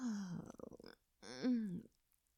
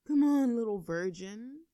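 loud taunt; 9 dB louder than usual. Acted. Taunting him.

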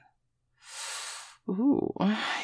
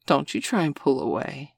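usual speaking voice

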